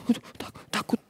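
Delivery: soft frightened breathy aduh, stammering tak-takut; mock scared whisper. Townsfolk shrinking back in fear from the gang. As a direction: act like a bystander who is afraid to look. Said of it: "Ngelihatnya itu takut".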